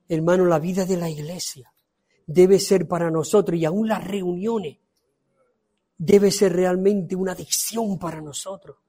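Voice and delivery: usual speaking voice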